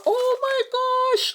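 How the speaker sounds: very high, sudden squeal of delight. Real, not acted. Opening the box for viewers, a very high delighted squeal. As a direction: squeal at the surprise. Discovers which doll she got.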